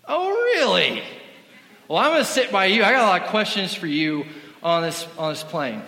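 high, loud impression of a drunk lady on a plane; tipsy lady voice. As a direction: play the tipsy passenger who blurts it out. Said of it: "she goes".

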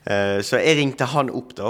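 normal talking voice

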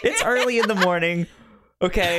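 high, quick excuse through giggles; laughing excuse. Real, not acted. Host laughing while excusing his wrong date, sheepish.

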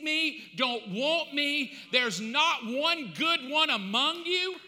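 preaching hard. Acted. Preaching at full force.

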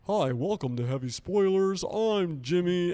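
high, loud, drawn-out host greeting; mock an annoying channel presenter, pushed up and loud; Jimmy impression. Mocking the new co-host's intro, annoying loud voice. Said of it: "what's wrong with this guy's voice".

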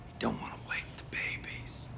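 hushed whisper, barely voiced. Shown as a demonstration. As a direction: whisper as if babies sleep nearby. Whispered coaching demo.

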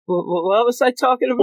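high, stammering mock confusion; acted bewilderment. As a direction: stammer it out, suddenly lost. Acting out Anya's sudden confusion.

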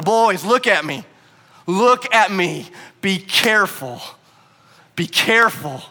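loud, slow, emphatic command; forceful warning. Acted. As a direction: stop them short like an urgent dad. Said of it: "That's the tone, that's the, the feeling".

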